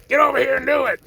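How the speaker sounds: high, loud mock bully; put-on domineering voice; bossy bully. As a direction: order someone about in a hammed-up shout. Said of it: "No one likes a bully".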